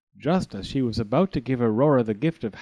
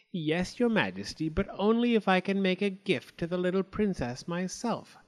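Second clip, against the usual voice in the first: sly, higher witch voice; witch with evil smile; 6 semitones higher than usual. A narrator's reading. Witch answering the king, sly and higher. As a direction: smile as you threaten. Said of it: "with an evil smile".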